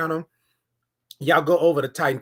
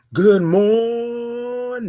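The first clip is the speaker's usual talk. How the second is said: very slow, stretched good morning, higher; longer greeting; 5 semitones higher, much slower than usual. Shown as a demonstration. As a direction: hold it long so they come in. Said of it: "say it a little bit longer".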